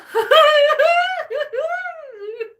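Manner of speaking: high, loud sobbing laughter; a long helpless cry-laugh; high howl of laughter; wheezing laugh-cry. Real, not acted. Laughing fit during ghost hunt.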